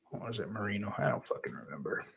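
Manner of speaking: muttered whisper to self. Real, not acted. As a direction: whisper it in frustration. Frustrated aside under breath.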